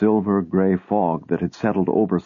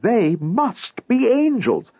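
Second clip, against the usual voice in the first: high, slow, lingering on angels; a husband convincing his wife, awestruck and slow; Lot, awed; 14 semitones higher, slower than usual. Acted. Awestruck Lot insists to his wife, high and slow.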